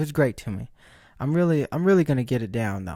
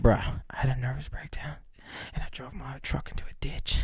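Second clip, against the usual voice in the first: whispering the buddy's excuse; breathy retold whisper of a lame excuse; mock whisper; 2 semitones lower, 8 dB quieter than usual. Buddy's excuse to the girl, retold, in a mock whisper. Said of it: "that's what he said".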